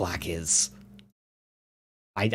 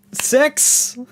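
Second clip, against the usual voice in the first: excited shout; 6 dB louder than usual. Real, not acted. Reacting to timer hitting six.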